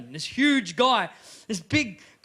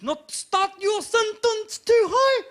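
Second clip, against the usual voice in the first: very high, slow exaggerated Maori accent; 12 semitones higher, 6 dB louder, much slower than usual. Shown as a demonstration. Comic teaching how to talk like a Maori, high-pitched accent. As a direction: start high and stretch it, Maori style. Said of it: "if you wanna talk like a Maori".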